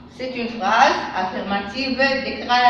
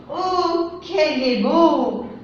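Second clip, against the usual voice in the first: admiring exclamation; 3 semitones higher, much slower than usual. Shown as a demonstration. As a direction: gasp in wonder at beauty. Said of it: "Phrase exclamative".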